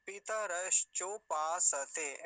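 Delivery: पितरश्चोपासते slow and firm, sa kept full; syllable by syllable. Shown as a demonstration. Teacher easing a hard word, slow syllable by syllable. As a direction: break पितरश्चोपासते into long clear syllables. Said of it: "ऐसा है".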